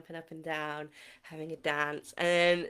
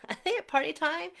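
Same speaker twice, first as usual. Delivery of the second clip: higher, fast, with a laugh; laughing excitedly; 10 semitones higher, 4 dB louder, much faster than usual. Shown as a demonstration. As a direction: laugh it out, giddy and quick. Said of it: "same question repeated, laughing".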